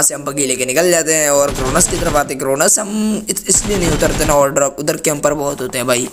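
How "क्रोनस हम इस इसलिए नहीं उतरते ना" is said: ordinary delivery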